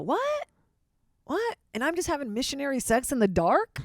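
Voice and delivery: high incredulous What, then a rant; shocked disbelief. Retold or imitated. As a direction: play someone stunned and jealous. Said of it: "and they go".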